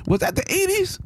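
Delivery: high, disbelieving question; incredulous. Real, not acted. Shocked about the era.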